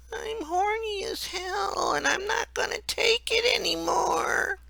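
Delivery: high, meek, nasal whimper; whiny nasal voice. A narrator's reading. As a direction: whine it out like a sad, pathetic nerd. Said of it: "a meek, whiny, nasal voice saying".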